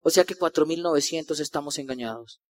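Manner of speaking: low, soft grumble to himself; sceptical aside. Retold or imitated. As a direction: drop low and quiet, doubting the whole crowd. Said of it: "y yo decía".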